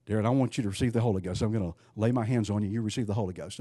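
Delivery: mock ritual. Shown as a demonstration. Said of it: "Now, I'm just gonna do something here".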